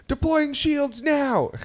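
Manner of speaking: very high, slow, earnest announcement; mock space-crew reply, high and drawn out; kid playing pilot. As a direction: imitate a boy answering the captain in character. Boy playing pilot on ride.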